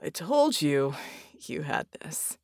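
hoarse voice, breaking into pauses; strained, just-revived rasp; weak croak. A narrator's reading. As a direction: croak it out with effort. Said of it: "Red croaked".

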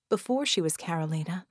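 plain reading voice

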